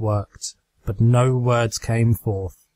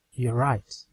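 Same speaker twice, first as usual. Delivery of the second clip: hollow; 6 dB quieter than usual. Acted. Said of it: "The mare's voice was hollow".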